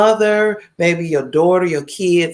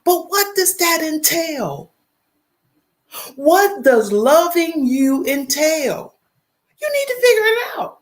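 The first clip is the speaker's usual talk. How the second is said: pleading with the chat through tears; choked-up real crying plea; tearful plea; 10 semitones higher, slower than usual. Real, not acted. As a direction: voice cracking much higher with a cry, slowed right down. Emotional plea to live chat.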